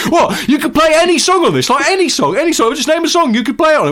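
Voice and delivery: high, loud, excitable shouting; hammed-up bellowed wonder; Greg Wallace. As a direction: yell it like a hyperactive, amazed presenter. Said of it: "a day in the life of Greg Wallace".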